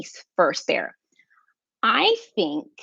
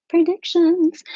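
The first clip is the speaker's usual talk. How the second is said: excited; 10 semitones higher, 5 dB louder, slower than usual. Real, not acted. Excited reaction to comments.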